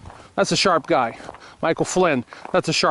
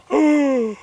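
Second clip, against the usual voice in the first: high, sharp mock gasp of horror; mock shocked gasp; 10 semitones higher, 4 dB louder than usual. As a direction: gasp as if hearing bad news. Mimics shock at the inauguration.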